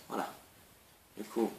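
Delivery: ordinary talk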